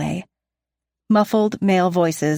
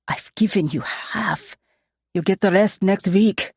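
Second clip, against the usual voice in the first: put-on Russian accent. A narrator's reading. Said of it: "the raven-haired man argues".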